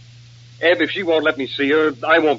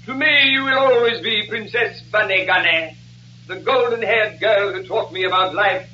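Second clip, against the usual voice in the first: high, ringing stage declamation; melodramatic protest; 5 semitones higher than usual. Acted. Colonel declares devotion to his princess, melodramatic protest. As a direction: protest grandly like an overwrought suitor.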